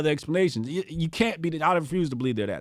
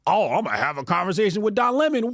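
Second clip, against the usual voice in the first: mocking eager voice; 3 semitones higher, faster than usual. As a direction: rattle it off like an excited fool. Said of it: "what did he do?".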